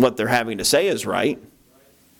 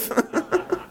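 breathy laugh; 4 dB quieter than usual. Real, not acted. Laughs at own story.

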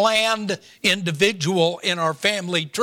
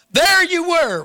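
playful reveal; 10 semitones higher, 4 dB louder, slower than usual. Retold or imitated.

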